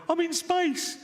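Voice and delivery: hammed-up high amazement; mock awestruck. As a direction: gush like a wide-eyed space tourist. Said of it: "float around going".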